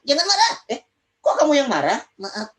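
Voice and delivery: the puppet snapping back in a high voice; puppet friend voice. Acted. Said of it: "teman Kak Ojan".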